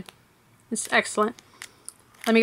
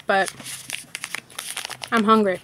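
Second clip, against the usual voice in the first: usual speaking voice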